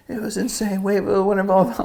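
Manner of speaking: high, excited retold exclamation; excited filmer, imitated. Filmer to surfer fresh out of the barrel, mock excited.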